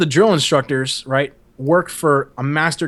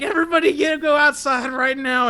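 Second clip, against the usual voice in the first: mock shout; 11 semitones higher than usual. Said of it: "and I was like".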